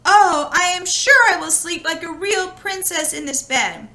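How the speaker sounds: bubbling with hope; girl sure she'll sleep like a princess; excited girl. Acted.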